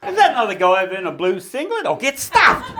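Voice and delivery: a blokey truckie muttering, going high and loud at the end; grumbling truckie. Retold or imitated. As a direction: play the truckie sizing up another bloke. Truckie voiced in a joke.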